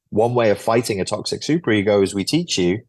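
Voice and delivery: usual speaking voice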